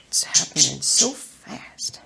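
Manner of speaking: slow breathy whisper. Real, not acted. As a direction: whisper it slowly in awe.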